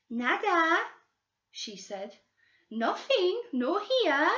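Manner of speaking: put-on high old woman with a Portuguese accent; Portuguese-accented old woman refusing flatly; Brazilian housekeeper. Old woman stonewalling the detectives in a Portuguese accent.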